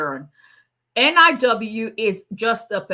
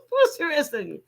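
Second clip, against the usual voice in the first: laughing; 8 semitones higher than usual. Real, not acted.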